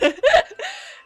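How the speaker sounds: burst of laughter. Real, not acted. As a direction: break into a real laugh. Laughing at her own TV licence joke.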